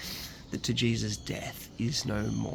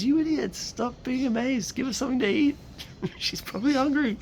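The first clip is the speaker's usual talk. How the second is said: high-pitched, hammed-up scolding; mock-indignant paraphrase, much higher; comic mock exasperation; 4 dB louder than usual. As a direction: scold them in a silly high voice. Comic paraphrase of Jesus's words.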